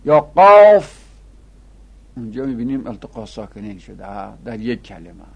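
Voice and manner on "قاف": قاف loud, high and drawn out; prolonged recitation. Shown as a demonstration. Teacher giving a Quran letter example, prolonged recitation.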